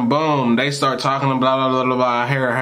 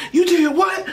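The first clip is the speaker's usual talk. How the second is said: shocked shout. Retold or imitated. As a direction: yell in outraged shock as mom. Mom hears son smoked.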